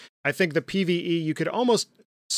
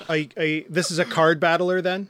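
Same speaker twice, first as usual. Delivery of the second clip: ordinary talk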